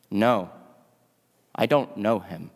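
low, subdued denial with hesitant pauses; a mildly scared, low-pitched disowning. Retold or imitated. Peter, scared, denies knowing Jesus, low and subdued. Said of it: "and he gets scared".

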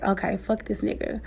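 soft, breathy, laughing kiss-off; muttered brush-off. Retold or imitated. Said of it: "And it's like".

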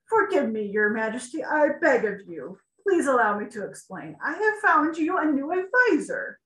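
pleading chancellor. Acted. Chancellor begs on his knees.

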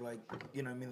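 ordinary talk, nothing marked

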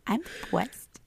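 put-on high lisping baby voice. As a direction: whine it like a toddler. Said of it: "Just throw baby voice on there".

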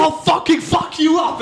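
high, shouted mock threat; drunk British bloke picking a fight. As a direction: yell like a drunk fan wanting to fight. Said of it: "everything went to fucking".

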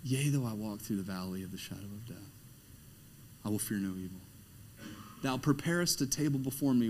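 hushed recitation. Acted. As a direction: let the scripture fall quietly, reverently. Reciting Psalm twenty-three.

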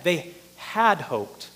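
very slow, "had" set apart and emphasized; stress on "had". Shown as a demonstration. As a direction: drag the phrase out, hitting "had". Repeats the stressed past tense.

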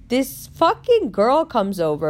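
ordinary delivery

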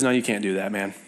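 low-pitched, understated dismissal; discouraging. Acted. Inner voice in the car after church, mildly discouraging. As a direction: talk them down in a low voice. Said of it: "This is the voice of discouragement".

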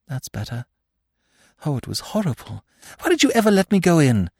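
put-on reproachful girl catching her breath; shaken girl. A narrator's reading. Girl reproaches the narrator after fainting, shaken.

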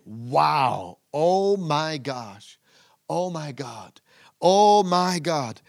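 slow, amazed gasps of wonder; imitating awe. Preacher acts out John seeing heaven, slow and amazed. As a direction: act out being stunned. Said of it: "This basically goes like this".

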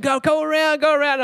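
high-pitched imitation of a frantic mother; mock yell. Mum urging her kid around the yard, high mock yelling. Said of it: "she starts yelling".

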